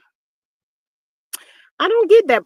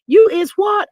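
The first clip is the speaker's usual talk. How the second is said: incredulous; 9 semitones higher, 7 dB louder than usual. Retold or imitated. Mocking the woman's claim.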